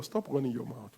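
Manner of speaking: soft low version. Shown as a demonstration. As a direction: mutter it to your neighbor. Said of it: "Tell your neighbor".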